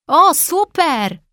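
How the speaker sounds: high, slow, drawn-out delighted cry; cheerful exclaim. A narrator's reading. Daniel cheers up the discouraged boy, high and excited. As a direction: make it sound like great news. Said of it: "wykrzyknął Daniel".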